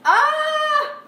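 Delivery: loud, high squeal; high shriek. Real, not acted. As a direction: let out a sudden high yelp. Cries out while handling meatball.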